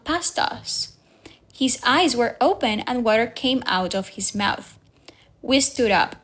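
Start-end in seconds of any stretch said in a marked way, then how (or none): none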